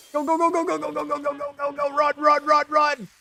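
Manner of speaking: high frantic yelling of go and run; yelling while running away. Real, not acted. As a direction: urge everyone to run, panicked. Fleeing enemies in game.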